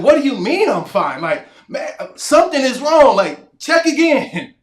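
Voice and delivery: panicked protest. Retold or imitated. Patient begging the doctor to check again, alarmed and loud. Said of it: "And I'm like".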